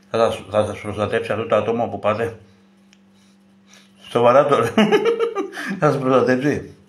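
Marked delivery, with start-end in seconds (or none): 4.8-5.7 sudden high-pitched laugh; high burst of laughter. Real, not acted. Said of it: "εγώ γελάω με τα χάλια σας". (ξαφνικό δυνατό γέλιο)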